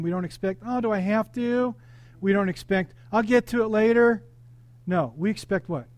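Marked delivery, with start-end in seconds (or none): 0.6-1.7 put-on whining child. Child answers parent told to clean the room, whining. 3.1-4.2 put-on higher, louder kid's put-off; stalling child. Child puts off parent about cleaning the room, stalling. Voices child putting off the chore.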